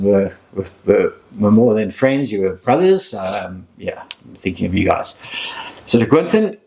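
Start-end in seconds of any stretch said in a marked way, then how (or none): 5.9-6.6 much higher, lifted toast call; raising a toast. Real, not acted. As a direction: lift the glass and call it out.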